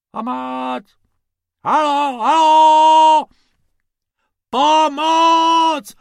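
long, high drawn-out hollers; distant cry swelling to a full yell; calling for help. Acted. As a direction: shout for rescue across the desert.

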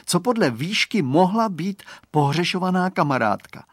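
ordinary delivery

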